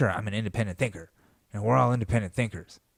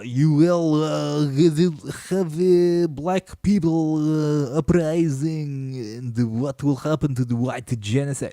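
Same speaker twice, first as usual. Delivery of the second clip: mock Russian troll; 5 semitones higher, 4 dB louder, slower than usual. Voicing Russian propaganda to Americans.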